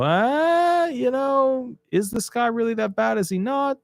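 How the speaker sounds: high, doubtful mock musing; skeptical official voice. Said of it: "we look at it and we go".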